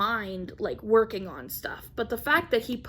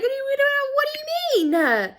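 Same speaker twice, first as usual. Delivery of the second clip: mock-distraught, stretched high protest; exasperated disbelief; 5 dB louder than usual. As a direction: cry it out in hammed-up dismay. Retells exasperated reply, acted.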